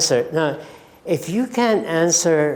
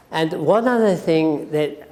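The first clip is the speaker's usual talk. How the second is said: ordinary talk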